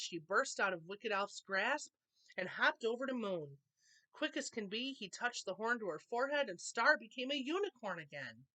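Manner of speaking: usual speaking voice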